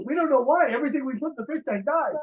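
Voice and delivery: high, puzzled put-on voice; mock clueless owner. Host joking as a hapless fish keeper, mock puzzled. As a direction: act baffled by your own mistake.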